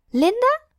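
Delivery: high, uncertain guess at a name; teacher guessing the former pupil's name, hesitant and high. Acted. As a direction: try a name, unsure. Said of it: "vraagt ze aarzelend".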